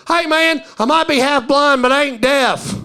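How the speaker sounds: high, loud indignant yell; imitated heckled patron bellowing back; hollering from outside. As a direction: shout it in from outside the building. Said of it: "the guy from outside go".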